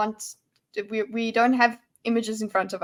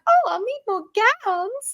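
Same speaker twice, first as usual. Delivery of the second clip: much higher, mock grand lady; noblewoman; 11 semitones higher than usual. Player mimicking how a noblewoman would ask, mock high-society. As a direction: put on an airy noblewoman voice. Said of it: "obviously as a noblewoman".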